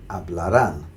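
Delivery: hablarán with emphasis on rán; hablarán, landing firmly on rán; final-syllable stress. Shown as a demonstration. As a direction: punch the last syllable of hablarán. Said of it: "stressing that very last syllable".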